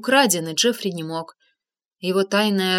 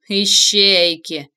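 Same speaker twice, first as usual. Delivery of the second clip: slow, cold metallic voice; 4 dB louder, much slower than usual. Acted. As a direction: drag it out like a machine. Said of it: "металлическим голосом произнёс Уилл".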